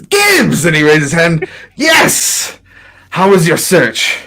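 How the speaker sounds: high, loud, glad call; excited greeting. Acted. NPC greets friend across room.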